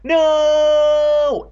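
very long high loud wail; crying out. A narrator's reading. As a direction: hold the scream out absurdly long. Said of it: "Evan cried".

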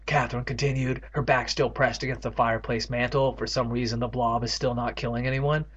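ordinary talk

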